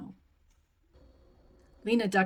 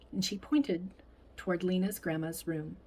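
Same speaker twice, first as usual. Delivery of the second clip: ordinary delivery, nothing marked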